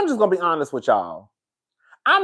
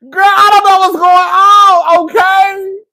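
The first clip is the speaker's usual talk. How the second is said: much higher, much louder, slow yell; exasperated shout; 13 semitones higher, 10 dB louder, slower than usual. Real, not acted. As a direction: belt it out in fed-up disbelief. Venting about the broken stream.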